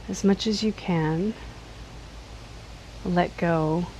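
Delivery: calm, unhurried body-scan voice with long pauses; slightly softened guiding voice, leaving space between phrases; guided meditation. Acted. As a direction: gently lead them into letting go, phrase by phrase. Leads a seated body scan.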